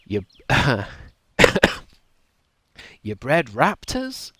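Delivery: slow, rising, disbelieving; incredulous question. Shown as a demonstration. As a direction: drag it out in disbelief. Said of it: "I would have delivered it like that".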